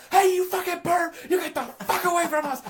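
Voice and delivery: high, loud imitated screaming threat; freaking-out cousin. Cousin yelling at a van driver, acted high shrieking freak-out. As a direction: yell it like a cocky kid freaking out. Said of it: "freaking out".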